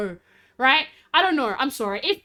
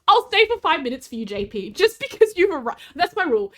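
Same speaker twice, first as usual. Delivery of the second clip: usual speaking voice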